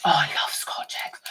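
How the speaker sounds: breathy whispered delight over Scotch eggs; a whispered aside to the viewers. Real, not acted. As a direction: whisper it like a guilty treat. Aside about loving Scotch eggs.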